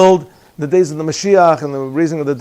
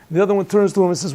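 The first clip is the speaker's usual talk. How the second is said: ordinary talk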